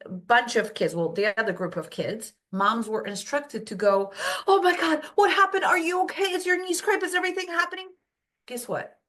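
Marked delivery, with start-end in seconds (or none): 4.5-7.9 high, alarmed fussing over a scraped knee; panicked mother, voice high and rushed; scared mom. Retold or imitated. Mom panicking over her fallen child, high and alarmed. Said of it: "worrying and scared".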